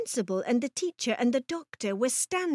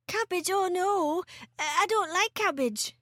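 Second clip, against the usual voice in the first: put-on high-pitched reluctant child; higher, whiny storybook boy; dismayed little boy; 8 semitones higher than usual. A narrator's reading. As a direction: plead your way out, like a small boy. George dreads being made to eat cabbage.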